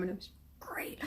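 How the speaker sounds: hushed, touched whisper; whispered emotion. Real, not acted. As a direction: let it fade to a whisper. Moved by wedding scene.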